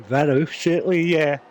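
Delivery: words stretched by a yawn. Real, not acted. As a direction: talk through a yawn. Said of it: "excuse me for yawning".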